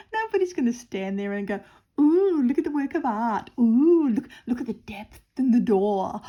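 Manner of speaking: loud, high, hammed-up gushing; husband mocking gallery-goers swooning, hammed-up gushing; mock art admirer. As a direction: ham up an awestruck art critic. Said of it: "He said".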